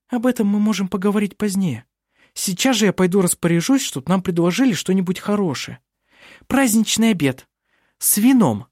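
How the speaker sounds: put-on high, bubbly girl voice; bright chatter of a lively girl; lively young woman voice. A narrator's reading. Narrator voices Vinga.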